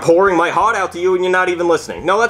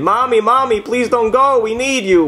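put-on crying kids; 7 semitones higher than usual. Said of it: "they're crying, saying".

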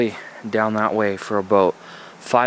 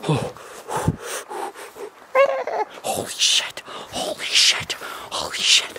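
slow, hushed whispered repeats; scared whisper; slower than usual. Acted. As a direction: whisper it over and over in terror.

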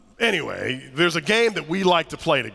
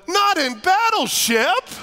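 mock petty retort; 12 semitones higher, slower than usual. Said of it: "I so wanted to say to him".

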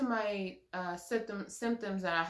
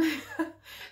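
breathy laugh; 8 semitones higher than usual. Real, not acted. Laughs after quoting her mom.

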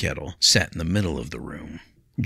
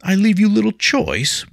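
high, loud incredulous echo; indignant disbelief; 8 dB louder than usual. A narrator's reading. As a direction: throw his words back at him. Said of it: "William asked".

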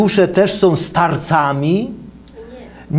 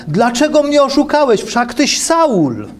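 higher, slightly raised read-aloud cry; cried out; 8 semitones higher than usual. A narrator's reading. Lecturer reading the witch's cry to Saul, mildly raised. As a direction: lift the pitch for the witch's accusing cry. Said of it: "krzyknęła wielkim głosem".

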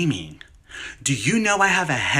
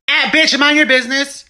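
sassy snap; 10 semitones higher, 8 dB louder than usual. Acted. Snapping at coworker mid-call.

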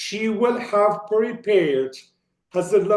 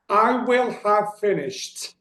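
careful reading; 4 semitones higher than usual. Shown as a demonstration. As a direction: spell out each word of "I will have finished" for learners. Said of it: "önce İngilizcelerini okuyorum".

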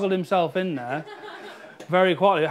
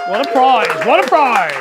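excited; 4 semitones higher, 8 dB louder than usual. Real, not acted. Celebrating the winner.

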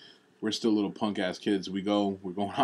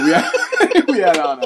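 higher and louder, breaking into laughter; laughing; 9 semitones higher, 13 dB louder, slower than usual. Real, not acted. Telling his cohost about the cheap suits, laughing. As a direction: laugh through the words as the memory hits. Cracking up mid-story.

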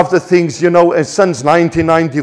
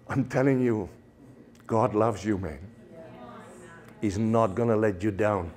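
much softer, moved and holding back tears; emotional; 7 semitones lower, 14 dB quieter than usual. Real, not acted. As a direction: speak softly, overcome with feeling. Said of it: "I'm a little bit emotional here".

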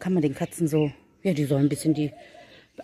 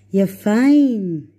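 happy praise; 4 semitones higher, 8 dB louder than usual. Real, not acted. Praising cats at feeding.

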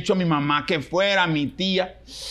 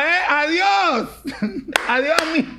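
mocking goodbye; 10 semitones higher than usual. Waving goodbye to everyone who doubted him, mocking. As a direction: wave off the doubters smugly.

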